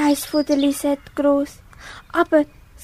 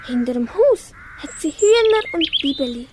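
ordinary delivery

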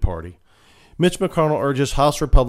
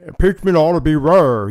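mock McConnell; 6 semitones higher, 7 dB louder than usual. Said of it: "he noted".

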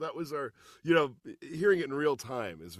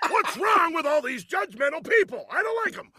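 much higher, loud hammed-up rant; mock yelling; 8 dB louder than usual. Father mocking a ranting lecture to his son, hammed-up shout. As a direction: rant like an exasperated parent.

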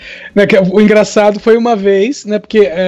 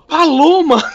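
higher, astonished exclamation of PALOMA; retold astonished realisation; 6 semitones higher, slower than usual. Teller spots the forgotten nickname on a bus, astonished exclamation. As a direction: cry PALOMA as the penny drops.

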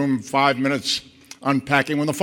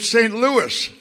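put-on young fan; 8 semitones higher than usual. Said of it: "She said".

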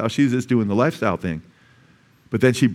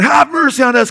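high, raised-voice plea; yelling from a distance; 11 semitones higher, 5 dB louder than usual. Acted. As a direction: call out loudly to someone far off. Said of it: "at a distance from them".

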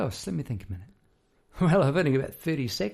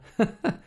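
short high laugh; bright laugh; 10 semitones higher, 6 dB louder than usual. Real, not acted. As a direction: laugh it off lightly. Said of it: "laugh after lyric".

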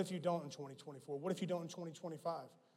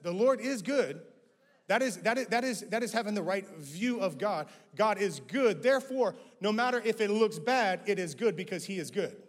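loud and higher, driving the point home; full-force preaching; 4 semitones higher, 7 dB louder than usual. Acted. To the congregation, proclaiming God is good at full voice. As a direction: preach it with rising fire.

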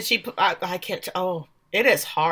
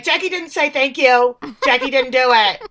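higher, louder tattletale protest; mock tattling; 6 semitones higher, 7 dB louder than usual. Missy tattles on Jackie after the group prayer, mock tattling. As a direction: tell on a friend loudly, voice pitched up.

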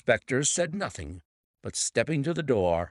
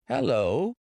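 startled, higher-pitched hello; surprised cry; 6 semitones higher, 3 dB louder than usual. A narrator's reading. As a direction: call out in puzzled surprise. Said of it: "cried the inspector".

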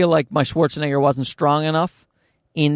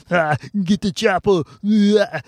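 exaggerated Austrian action-hero impression; strained mock Austrian bellow; Arnold Schwarzenegger; 4 semitones higher than usual. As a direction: ham up a thick Austrian accent. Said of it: "I started doing Schwarzenegger".

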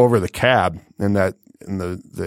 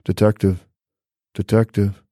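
unassuming [name] voice. Retold or imitated. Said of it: "this very unassuming voice".

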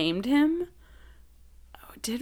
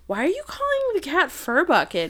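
mom; 8 semitones higher than usual. Retold or imitated. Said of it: "my mom was like".

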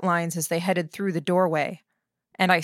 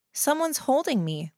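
higher voice, too calm; detached, as if not wholly herself speaking; distant, disaffected; 8 semitones higher than usual. A narrator's reading. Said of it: "Her voice sounded distant, disaffected".

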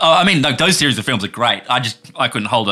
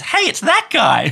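put-on much higher, drawn-out kid's exclamation; excited child; 3 dB louder, slower than usual. Child pointing at the screen, high excited voice. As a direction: squeal it like a child spotting someone. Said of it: "the child points at the screen".

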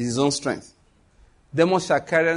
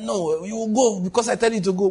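higher, insistent voice of God; 6 semitones higher than usual. Acted. God overruling the reluctant Moses, insistent and high. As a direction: lay down the order as God, pitched up. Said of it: "He said".

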